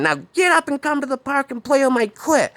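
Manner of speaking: very high crazed woman; Sarah. Retold or imitated. Woman ordering David to get up, high crazed voice. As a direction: order it in a shrieky high put-on voice. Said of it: "she was saying".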